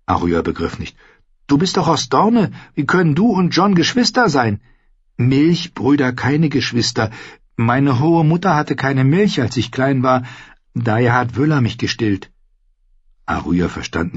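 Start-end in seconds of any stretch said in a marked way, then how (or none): 1.5-4.6 put-on high, questioning girl's voice; puzzled young Arya. Arya questions Ned about the kinship, high and puzzled. 5.2-12.3 higher, explaining squire voice; lightly voiced earnest young squire; young squire Ned. Ned explains milk brothers to Arya, higher and earnest.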